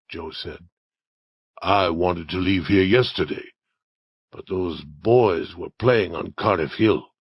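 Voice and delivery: a hint of a scheming outlaw; lightly voiced Indian Joe. Story figure Indian Joe talks to accomplice.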